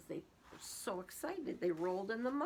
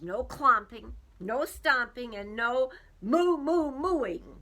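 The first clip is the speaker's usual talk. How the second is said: louder, firm farmer listing rules; stern list of rules; 4 semitones higher, 9 dB louder, slower than usual. Acted. Farmer scolding excited cows, firm and loud. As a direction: lay down the law to the cows. Farmer lists what is banned.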